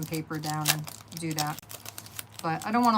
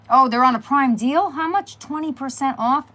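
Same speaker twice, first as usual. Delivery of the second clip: excited surprise; 7 semitones higher, 9 dB louder than usual. Real, not acted. Spots a Prime deal.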